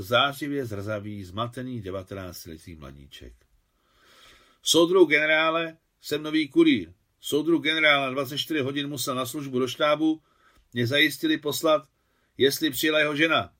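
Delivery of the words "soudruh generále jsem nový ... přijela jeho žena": put-on loud, higher-pitched young courier; loud military report from a confused youngster; rattled-off report. A narrator's reading. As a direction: blurt the message out like a flustered recruit. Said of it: "rychle vypálil mladý kurýr".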